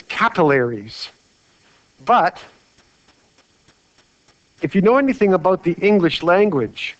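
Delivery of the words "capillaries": capillaries with the stress up front on CAP, "CAP-uh-lair-eez"; first syllable stressed, third like "lair" in capillaries; common variant pronunciation. Shown as a demonstration. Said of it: "hear people say this as".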